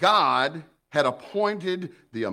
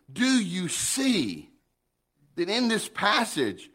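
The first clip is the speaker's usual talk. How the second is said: ordinary talk, nothing marked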